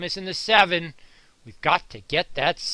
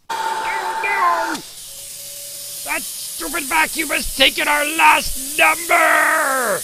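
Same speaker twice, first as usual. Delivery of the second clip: mock outrage; 9 semitones higher, 5 dB louder, slower than usual. Vacuum steals the last number.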